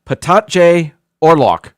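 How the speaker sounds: "Patatje oorlog" slow and loud, word by word; phonetic sounding-out. Shown as a demonstration. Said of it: "I wrote it down phonetically".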